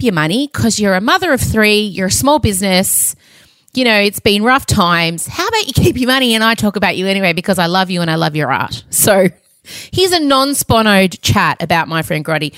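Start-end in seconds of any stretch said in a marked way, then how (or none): none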